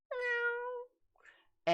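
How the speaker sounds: high, soft cat meow; a small, gentle meow; a quiet little cat meow. Acted. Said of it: "it does a quiet meow".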